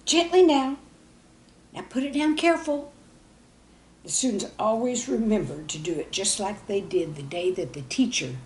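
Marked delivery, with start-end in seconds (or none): 0.0-2.9 put-on kid instructing. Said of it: "someone would say".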